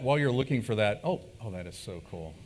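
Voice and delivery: ordinary talk, nothing marked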